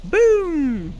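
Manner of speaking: triumphant boom; excited boom. Pretend. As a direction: shout it like a kid setting off a blast. Blowing up the door.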